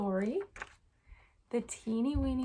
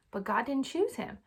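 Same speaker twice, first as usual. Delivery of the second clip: plain reading voice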